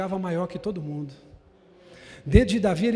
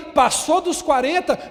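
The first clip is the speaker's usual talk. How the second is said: mock ad voice; 7 semitones higher, 9 dB louder, slower than usual. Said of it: "Diz assim, ó".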